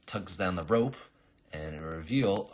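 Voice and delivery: ordinary talk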